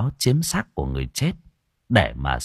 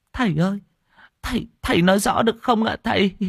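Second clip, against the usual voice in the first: much higher, fast pleading on the verge of crying; near tears; 11 semitones higher, faster than usual. Acted. Old woman begs the monk, near tears and much higher. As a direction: whimper your plea, about to cry. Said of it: "nói mếu máo như muốn khóc".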